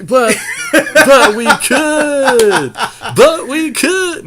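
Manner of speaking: high squeal through laughter; cracking up while insisting; laughing. Real, not acted. Insisting to teammate, laughing hard. Teasing partner over deck choice.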